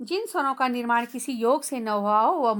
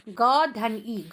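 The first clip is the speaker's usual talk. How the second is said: each sound said slowly and apart; slow sound-by-sound spelling; 2 semitones lower, much slower than usual. Shown as a demonstration. Teacher to children, breaking a word into sounds slowly. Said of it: "ग में बड़ी ई की मात्रा है".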